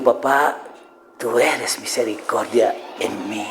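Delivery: soft, slow, low and assured; calm, intimate prayer. Shown as a demonstration. Believer talking to God as Papá, calm intimate prayer. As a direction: speak tenderly to a father, slow and hushed. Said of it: "¿Ves la diferencia?".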